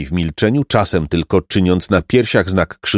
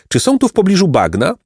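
high, mildly tremulous plea; trembling voice; 12 semitones higher, faster than usual. A narrator's reading. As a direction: ask timidly, pitched well up. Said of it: "zapytał drżącym głosem nieborak".

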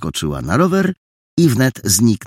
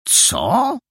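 much higher startled question; can't believe his ears; sharp high surprised what; astonished. Acted. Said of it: "zdumiał się Paragon".

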